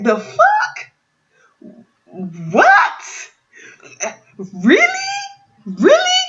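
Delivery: high-pitched, disbelieving questions; retold squeal of disbelief; incredulous. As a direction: shoot up high in stunned disbelief. Acted disbelieving reaction to the guest.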